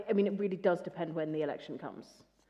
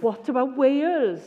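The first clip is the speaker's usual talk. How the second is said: put-on drawn-out, loud, higher Welsh accent; 9 semitones higher, 14 dB louder, slower than usual. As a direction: Leanne Woods in a big, slow Welsh accent. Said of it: "have to do the accent".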